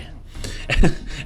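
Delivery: short breathy laugh. Real, not acted. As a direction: let out a quick laugh. Laughs at own joke.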